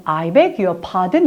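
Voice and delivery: slow, polite I beg your pardon with rising end. Shown as a demonstration. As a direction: ask very politely, stretching each word. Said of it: "조금 더 공손하게 되면".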